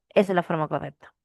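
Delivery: ordinary delivery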